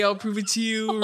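mock him, stretching the final "you"; mocking echo. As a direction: throw "I'll prove it to you" back mockingly. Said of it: "I'm nothing if not a fucking dick".

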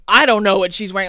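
loud curt cop voice; rude cop. Retold or imitated. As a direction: bark it back dismissively.

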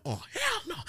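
high-pitched indignant outcry; retold high yelp of refusal; indignant outburst. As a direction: blurt it way up high, outraged. Reacting to being kicked.

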